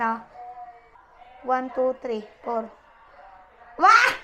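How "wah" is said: high, happy "wah"; delighted squeal. Real, not acted. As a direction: squeal with joy at the reveal. Camera flipped, delighted squeal.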